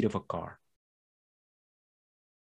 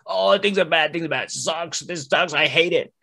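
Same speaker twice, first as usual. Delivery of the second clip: mock whining; 5 semitones higher than usual. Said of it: "It's like".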